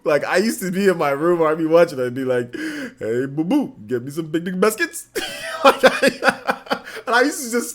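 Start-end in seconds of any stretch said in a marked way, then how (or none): 3.0-5.1 cartoon bear voice swooping upward; sing-song cartoon bear impression; Yogi Bear. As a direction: play the cartoon bear, lifting each phrase end. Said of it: "hours doing Yogi Bear".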